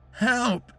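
high cry of pain; having a heart attack. A narrator's reading. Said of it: "cried out the man, shaking with pain".

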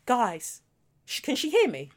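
small, hushed, halting mock query; freeze, then ask the room under your breath; imitated dumbfounded panic; stunned shock. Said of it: "It was the shock".

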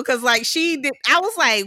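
ordinary delivery

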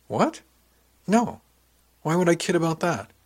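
high, startled protest; indignant disbelief. Acted. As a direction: protest at being doubted, pitch jumping up. Indignant reply to being doubted.

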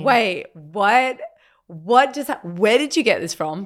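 retold surprise. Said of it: "And I was like".

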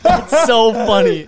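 loud, high words through laughter; laughing hard. Real, not acted. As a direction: drag it out while cracking up. Whole group cracking up at joke.

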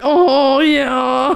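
imitated reaction, drawn out and loud; Joel. Host mimicking co-host's reaction to English accent, loud imitation. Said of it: "Joel's just like".

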